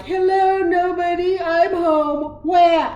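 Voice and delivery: high, loud sing-song wail ending in a sob; half-sung lonely hello that breaks into a mock wah; mock wailing cry. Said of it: "I was crying".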